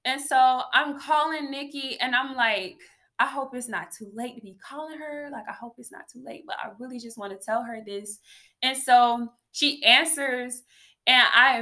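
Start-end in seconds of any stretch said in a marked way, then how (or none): 3.2-8.2 quick, hushed muttering to herself; hurried inner worry. Retold or imitated. Calling a friend late at night, hushed and hurried. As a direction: rush it under your breath as retold thought.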